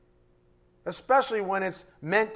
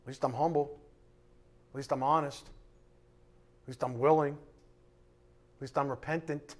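lower, soft, slowing to the end; humble confession; 4 semitones lower, 6 dB quieter than usual. Real, not acted. Preacher admitting his own failings, soft and low. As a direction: confess it quietly, drawing out the last.

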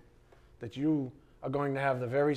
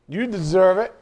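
mock spite; 3 semitones higher, 5 dB louder than usual. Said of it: "Sometimes I feel like saying".

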